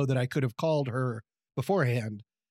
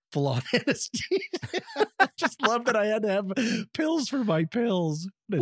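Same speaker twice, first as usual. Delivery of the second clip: laughing; 6 semitones higher than usual. Real, not acted. Laughing at her own story.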